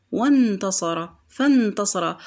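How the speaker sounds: وانتصر، فانتصر jumping straight from wa or fa to n; no glottal stop before the n in وانتصر، فانتصر; hamza dropped. Shown as a demonstration. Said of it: "قفزت إلى حرف النون مباشرة".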